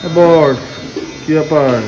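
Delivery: calling out. Real, not acted. Calling the groom across room.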